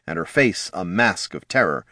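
plain reading voice

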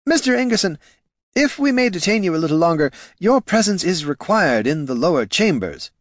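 quick, high, breathless aide; breathless messenger. Acted. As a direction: rush the words out like a winded messenger. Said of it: "breathlessly greeted".

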